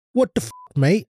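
a jokey fake Australian voice; mock Aussie accent. Podcaster mocks an Aussie reaction in a fake Australian accent. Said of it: "What kind of accent is that?".

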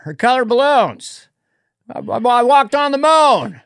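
high-pitched, rambling politician; earnest speech tumbling into stammers and mangled phrases; Joe Biden. Acted. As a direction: insist in a high, stumbling voice. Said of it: "I did Biden last night".

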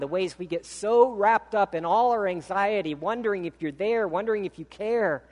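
earnest, rising prayer voice. Acted. Pastor leading the church in prayer, earnest fervent prayer. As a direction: pray it with fervor.

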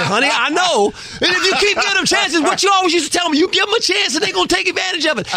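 high, laughing reply to wife; exasperated husband. Retold or imitated. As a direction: reassure her, half laughing. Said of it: "I said".

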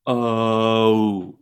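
"oh" drawn out, gliding between two vowel parts; long, sliding "oh"; diphthong demo. Shown as a demonstration. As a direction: stretch "oh" so the vowel moves. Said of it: "when both of you just did that".

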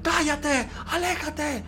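high-pitched mock screams of threat; shrieked imitation, pitch way up; imitated screaming. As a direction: act out a child yelling in their sleep. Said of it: "de pequeño gritaba mucho".

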